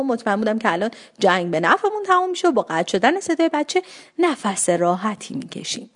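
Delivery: ordinary delivery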